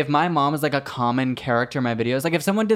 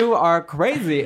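laughing; 5 semitones higher, 4 dB louder than usual. Retold or imitated. Laughing at creepy comment.

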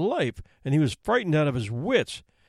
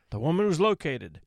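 ordinary delivery, nothing marked

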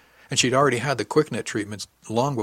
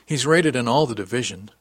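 ordinary delivery

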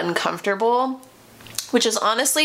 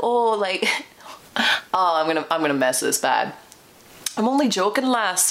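put-on a clumsy, half-laughing try at a Scottish accent, comes out Irish; imitating his Scottish accent badly. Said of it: "I'm really bad at accents".

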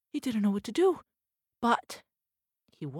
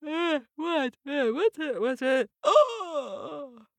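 a touch higher, woken-up mumble then alarmed; groggy papa mouse; 5 semitones higher, 4 dB louder, slower than usual. Acted. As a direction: wake up confused, then startle.

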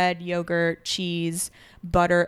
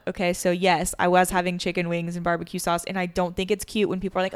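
ordinary talk, nothing marked

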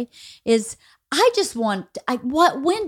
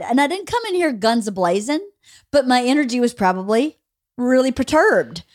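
normal talking voice

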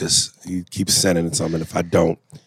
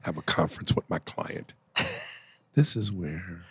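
much softer, near-whispered aside; 3 semitones lower, 11 dB quieter than usual. Real, not acted. Host confers with co-host about the caller, hushed whisper. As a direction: lean in and whisper to your co-host.

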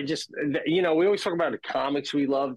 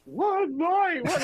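mocking little brother; much slower than usual. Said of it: "my little brother goes".

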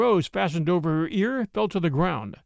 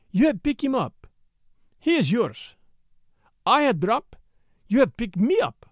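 broken English; 2 semitones higher than usual. A narrator's reading. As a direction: tease in pert broken English. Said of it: "in a broken English that seemed as much infantine as foreign".

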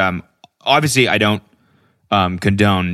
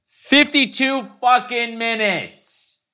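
very high, slow, drawn-out disbelief; comic high-pitched outrage, every word held; exasperated squeal; much slower than usual. Retold or imitated. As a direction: stretch it out in a strained high squeal. Repeats GPS time in outrage.

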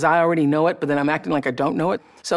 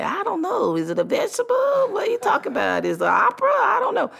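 very high, baffled street voices; puzzled passers-by. Retold or imitated. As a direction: play confused people on the street. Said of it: "And people say".

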